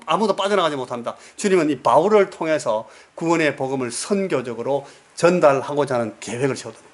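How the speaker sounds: ordinary talk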